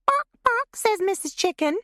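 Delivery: a mocking girl cackling like a hen; mock chicken. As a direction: play a silly chicken to taunt her. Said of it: "flapping her arms, elbows bent".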